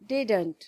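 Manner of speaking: didn't said as one syllable; contracted didn't. Shown as a demonstration. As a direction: squeeze did not into didn't. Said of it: "short form में हम लिखते हैं".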